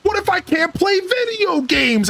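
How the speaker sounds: put-on high, panicky child wailing about heaven; little boy panicking to grandma, rising to a yell; freaking-out little kid. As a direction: whine and build to a shout like a scared little boy. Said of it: "freaking out".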